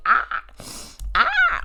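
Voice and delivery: sudden high squeal to shake off tears; yelped release of emotion; short cry. Real, not acted. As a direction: let out two sharp "ah"s, the second very high. Trying to stop herself crying.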